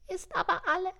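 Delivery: high, thin piping insistence; stubborn elf squeak. A narrator's reading. Elf contradicting Santa, piping stubbornly. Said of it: "piepste die Elfe hartnäckig".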